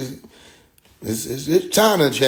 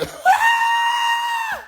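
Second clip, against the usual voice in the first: shrieking laugh. Real, not acted. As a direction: scream with laughter. Laughs at own story.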